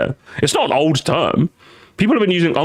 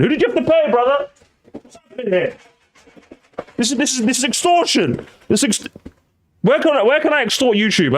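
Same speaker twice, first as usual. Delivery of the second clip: higher, hammed-up indignation; mock outrage; 9 semitones higher than usual. Streamer mock-rants at a big YouTuber, higher hammed-up indignation. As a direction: play up fake outrage at the paywall.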